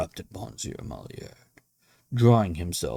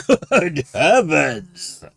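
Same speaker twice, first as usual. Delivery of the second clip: loud, slow, drawn-out cry; shocked exclamation; 9 semitones higher, 11 dB louder, much slower than usual. A narrator's reading. Host shocked at the guest's ignorance, exclaiming. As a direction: recoil in disbelief. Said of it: "ejaculated my host".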